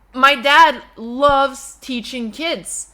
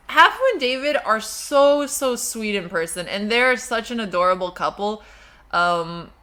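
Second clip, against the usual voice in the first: normal talking voice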